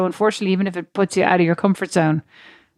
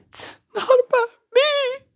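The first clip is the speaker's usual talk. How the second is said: much higher, wailing through mock tears; imitated sobbing wail. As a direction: squeak it out like a blubbering mess. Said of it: "and I was going".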